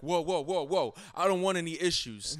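loud, high "don't hurt me" quote; retold alarmed protest. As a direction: imitate a guy hastily calling off a fight. Quotes man surrounded by the group.

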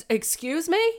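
high, affronted "excuse me?". Retold or imitated. Offended reply to teacher slight.

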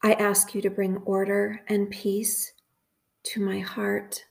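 slow, measured prayer with long pauses; guided meditation prayer. Acted. Host praying phrases for listeners to repeat, slow guided meditation. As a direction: say each phrase calmly and unhurried, leaving room to repeat.

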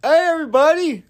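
retold fan hollering to the whole store; loud, high call-out, slowing on the announcement; excited announcing shout. Fan announcing a celebrity to the shoe store, shouted.

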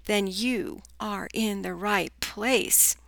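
ordinary talk, nothing marked

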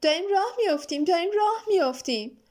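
high, fast, delighted cry; thrilled boy's cheer; joyful shout. A narrator's reading. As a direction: shout it with glee. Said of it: "میلو با خوشحالی فریاد کشید".

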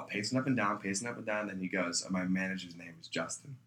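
ordinary talk, nothing marked

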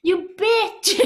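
high, loud mock yell; mock angry shout. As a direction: hurl the insult, half laughing. Everyone voiced cursing.

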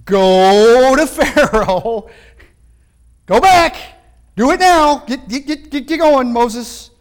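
loud, high, booming voice of God ordering Moses; God commanding. Retold or imitated. Preacher voicing God telling Moses to go, loud and urgent. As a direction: command him to get moving now. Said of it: "He says, uh".

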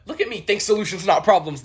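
a hint of a bossy grandma; lightly voiced grandma. Grandma Josephine scolding Lisa, lightly voiced. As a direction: lecture like a pushy grandmother. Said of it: "my grandma Josephine".

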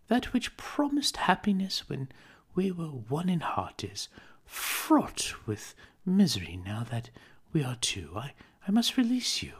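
put-on a young woman letting go of her fiancé; Belle, sorrowful. Belle parting from young Scrooge.

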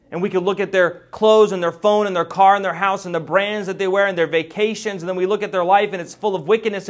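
loud, high fervent sermon delivery; preaching. Acted. Preacher thundering to the congregation about the wicked's riches. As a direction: thunder it from the pulpit.

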